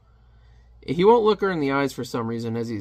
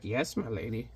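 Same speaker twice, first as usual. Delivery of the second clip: very soft, lower servile voice; meek minion; 4 semitones lower, 11 dB quieter than usual. Acted. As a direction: murmur it as an obedient servant. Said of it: "little Timon guy's just like".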